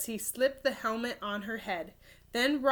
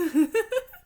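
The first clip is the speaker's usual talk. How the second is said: real laugh; 12 semitones higher, 5 dB louder than usual. Real, not acted. Narrator laughs at own slip.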